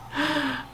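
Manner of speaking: sharp gasp. Real, not acted. Reacting to her own story.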